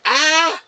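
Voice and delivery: high, loud fake scream; mock scream. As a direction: shriek 'Ah!' like a scared kid. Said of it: "It was more like".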